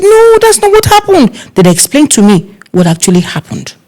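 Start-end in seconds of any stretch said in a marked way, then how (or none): 0.0-1.3 high protesting no; guests protesting. Retold or imitated. Guests telling the planner she was wrong, high protest. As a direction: correct her, pitched up. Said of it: "Then everybody said".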